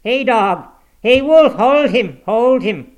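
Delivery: high, very loud calls to dogs; full-voiced hound-rousing holler; old man's shout. A narrator's reading. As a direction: shout urgent commands to set the dogs on him. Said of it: "shouted the ancient".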